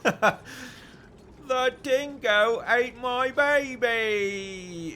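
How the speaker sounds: hammed-up Australian accent, high and loud, breaking into laughter; mock Australian accent with laughter leaking in; laughing Australian accent. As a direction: drag out the Aussie line while cracking up. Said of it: "do your best Australian accent".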